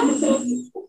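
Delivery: brief high giggle; small high-pitched titter; laugh. Real, not acted. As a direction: laugh softly at your own confusion. Laughs at own confusion.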